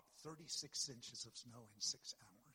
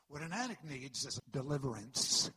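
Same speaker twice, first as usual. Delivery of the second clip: much louder punch on deliverance; preaching at full force; 12 dB louder than usual. Real, not acted. Pastor declaring to the church what addicts need, loud. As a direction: proclaim it as the answer.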